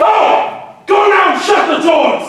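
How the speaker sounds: high, loud command; furious shout. Acted. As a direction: drive her out with a roar. Ajax orders wife out.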